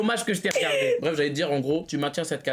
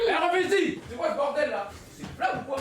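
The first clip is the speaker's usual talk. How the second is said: mock anger; 9 semitones higher than usual. Mock confrontation skit.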